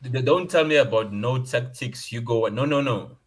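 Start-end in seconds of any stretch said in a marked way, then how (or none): none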